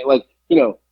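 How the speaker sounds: normal talking voice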